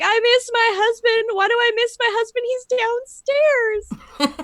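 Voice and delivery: high, slow, whiny mock sob; imitating a laughing, teary breakdown in the shower; mock crying wail. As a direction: replay your teary bafflement, hammed up. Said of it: "I started crying".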